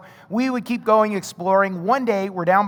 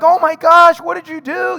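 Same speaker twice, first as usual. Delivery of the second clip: high, loud alarmed gasp of a mom; playful imitation of a panicked mom; mock mom panic; 7 semitones higher, 7 dB louder than usual. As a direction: imitate a mother freaking out. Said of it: "they're freaking out".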